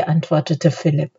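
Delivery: ordinary delivery, nothing marked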